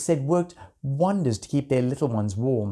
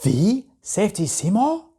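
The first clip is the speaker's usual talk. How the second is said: a high, louder, astonished question; surprised queen; 7 semitones higher, 4 dB louder than usual. A narrator's reading. The dragon queen hears the famous bear's name, surprised. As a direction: ask it in sudden delighted surprise. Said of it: "looking in surprise".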